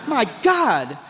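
slow, drawn-out mock amazement; awestruck. Drunk man admiring himself in the mirror, awestruck. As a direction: stretch it out in hammed-up self-admiration.

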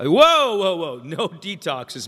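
loud, high burst, then slowing down; yelped objection easing into a firm refusal; alarmed protest. Retold or imitated. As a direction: cut them off in comic alarm. Acting out his alarmed refusal.

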